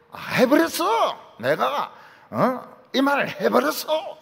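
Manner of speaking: high, slow Gyeongsang-dialect cry on 해버렸어; 해버렸어 flung up high and loud in Gyeongsang tune; Gyeongsang exclaim. Shown as a demonstration. Preacher demos the Gyeongsang way of saying it. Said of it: "이런 말 많이 합니다".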